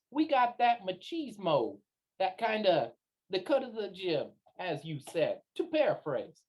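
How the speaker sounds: butter him up with a swaggering, stretched delivery; smooth-talking maverick. Acted. Player speaking as their character.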